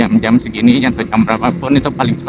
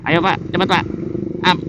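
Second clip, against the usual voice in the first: chipper text to speech voice; 7 semitones higher than usual. Pretend. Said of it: "manusia menirukan suara A I".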